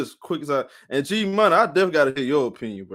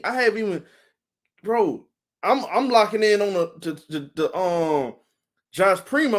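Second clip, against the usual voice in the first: usual speaking voice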